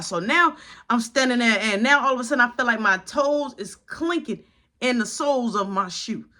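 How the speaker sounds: ordinary talk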